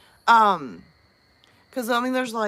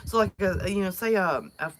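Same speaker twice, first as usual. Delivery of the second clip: normal talking voice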